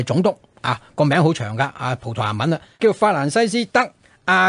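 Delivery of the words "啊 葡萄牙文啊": ordinary delivery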